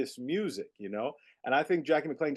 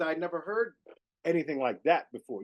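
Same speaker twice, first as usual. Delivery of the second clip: normal talking voice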